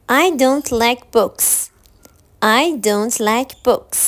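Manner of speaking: slow, loud teaching demo; slow model answer. Shown as a demonstration. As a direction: read the answer out slowly to the class. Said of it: "چند ثانیه فکر کنید".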